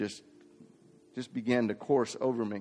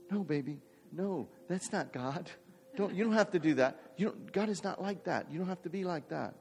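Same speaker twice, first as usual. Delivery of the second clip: soft, gentle mock wife comforting; wife soothing softly; 6 semitones higher, 6 dB quieter than usual. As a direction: hush him kindly like a worried wife. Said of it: "my wife is going".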